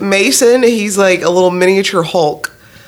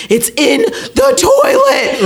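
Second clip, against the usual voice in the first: high, drawn-out mock wail; slow, high-pitched whine of despair, acted out; child breaking down; 12 semitones higher, much slower than usual. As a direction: imitate a little kid wailing to Mom. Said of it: "And he just breaks down".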